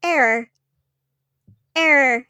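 flat computer error voice; robotic error beeps; glitching robot. Acted. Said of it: "it just goes".

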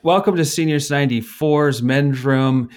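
ordinary talk, nothing marked

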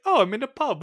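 high, delighted mock surprise; happy Thor. Fan imitating Thor realizing he's in a pub, happily delighted. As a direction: brighten up high, as if pleasantly surprised. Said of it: "he's just so happy".

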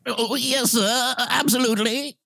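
eager bellhop. Acted. Bellhop answering his uncle.

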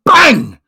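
loud, high mock gunshot; gunshot bang. Telling the bear joke, a loud shouted mock gunshot. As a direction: blurt the bang out like a gun firing.

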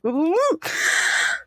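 high little sound to collect yourself; composing sigh. Acted. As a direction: pull yourself together with an audible sigh. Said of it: "does the, like, little composure thing".

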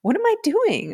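high, incredulous yelp of a question; mock-panicked what am I doing; bewildered self-question. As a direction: ask yourself in disbelief, pitch jumping up. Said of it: "I'm like".

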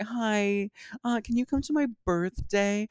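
high sugary mock invitation; mock bride invite. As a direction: ask sweetly as a demanding friend. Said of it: "when it's like".

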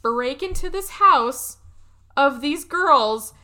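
slow build to a loud outburst; incredulous outrage. Retold or imitated. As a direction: stretch it out, then land hard and loud. Retold outraged reaction to film.

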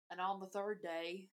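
mock Southern preacher-boy accent, measured and solemn. Quoting the opening of Mean Girls, mock Southern accent. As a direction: recite it with a put-on Southern twang. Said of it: "reminds me of the opening of Mean Girls".

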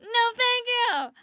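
high, laughing squeals of panic; hammed-up panicked yelling through laughter; mock panicked shrieks. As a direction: shriek like a fish is about to touch you. Snorkeling panic when fish approaches.

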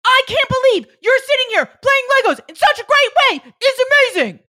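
very loud, high-pitched shouted praise; demonstrated high, full-volume cheering yell; positive yelling. Shown as a demonstration. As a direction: yell praise as if scolding, joyfully over the top. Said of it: "positive yelling".

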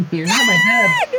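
high, loud mocking squeak; shrill parroting of the robot; squeaky robot voice. Mimicking Frank's squeaky line.

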